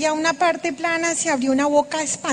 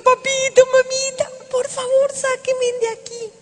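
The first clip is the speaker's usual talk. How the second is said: high, louder cry to Daddy and Mommy; begging shout; 10 semitones higher, 3 dB louder than usual. Retold or imitated. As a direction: call up to your parents, begging to be saved. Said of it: "le grito".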